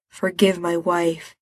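plain reading voice

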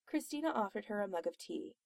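usual speaking voice

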